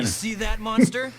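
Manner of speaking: high, loud taunting shout; triumphant taunt. Acted. Jim taunting the defeated monster, loud. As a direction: gloat over the beaten foe.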